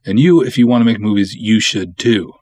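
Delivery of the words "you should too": normal talking voice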